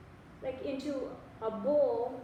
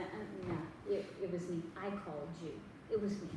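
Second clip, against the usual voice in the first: lightly voiced God, lower and softer; God speaking; 5 semitones lower, 7 dB quieter than usual. God reassuring the doubter he called him, low and soft. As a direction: answer quietly and low, as God. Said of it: "And God is saying".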